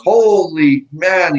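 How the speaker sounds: slow, loud, high retold amazement; amazed exclamation. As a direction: stretch it out in disbelief. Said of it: "and he goes".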